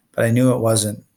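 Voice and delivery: plain reading voice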